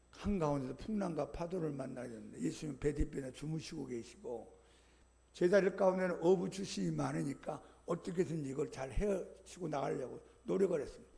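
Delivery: ordinary talk, nothing marked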